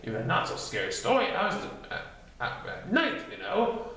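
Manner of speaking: high, bouncy voice, quick in the middle; interrupting Tigger. Acted. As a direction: cut in eagerly. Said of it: "then interrupted".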